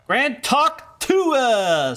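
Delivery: high, drawn-out mock announcement; big silly slow-motion holler; hammed-up proclamation. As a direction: stretch the name out theatrically. Hams up a name from game.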